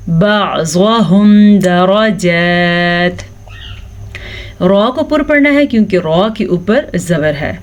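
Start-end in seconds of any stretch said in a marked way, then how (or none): 0.1-3.3 slow بعضهم with a clear ع from the throat; proper ع recitation. Shown as a demonstration. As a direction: recite بعضهم درجات slowly, voicing the ع. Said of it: "آپ نے پڑھنا ہے".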